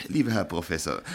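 normal talking voice

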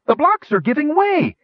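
high, loud gnome cheer of delight. A narrator's reading. Gnome Flash cheering as the obelisk's blocks give way. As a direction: shout it up like a thrilled little gnome. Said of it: "he cheered".

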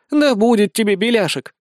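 high, teasing jibe; giggling. A narrator's reading. Woman teases the raging wizard, giggling tone. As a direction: tease lightly, as if about to giggle. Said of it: "захихикала Безос".

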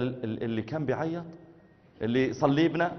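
quiet Egyptian question; hushed Egyptian asking about the imam; soft Egyptian wondering; Egyptian Egyptian man. Retold or imitated. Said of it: "أخ مصري".

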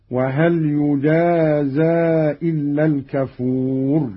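يجازى as yujaazaa; Qalun's passive reading. Shown as a demonstration. Teacher shows Qalun's reading, يجازى as yujaazaa. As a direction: say يجازى with ya and open zay. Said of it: "بالياء".

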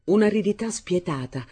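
ordinary delivery, nothing marked

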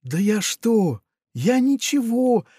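high, slow, shy piglet voice; bashful piglet. A narrator's reading. Funtik shyly brushes off the pirate's thanks, high and slow. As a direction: shrug off the praise bashfully. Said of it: "потупился Фунтик".

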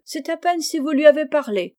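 Jo s'écrie, voix haute et forte; exclamation de reproche. A narrator's reading. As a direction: rabrouer sa sœur en s'exclamant. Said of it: "s'écria Joe". (exclamation vive, voix perchée)

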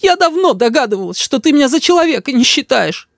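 high, wounded mother; high-pitched hurt complaint; tearful reproach. A narrator's reading. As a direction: accuse him while working up tears. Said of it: "Мама начинает тереть глаза".

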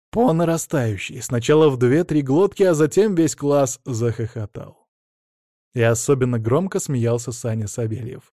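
usual speaking voice

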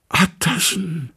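strange high voice, slow falling plea; a cornered creature begging from behind the door; Hyde's pleading voice. A narrator's reading. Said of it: "das ist Hydes Stimme". (fremde, hohe, flehende Stimme)

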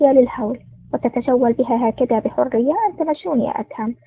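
ordinary delivery, nothing marked

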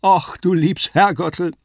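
slow, high, loud puppet wail; Kasperl lamenting. A narrator's reading. Kasperl hearing about the slaughterhouse, high drawn-out lament. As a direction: moan it woefully up high. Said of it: "jammerte Kasperl".

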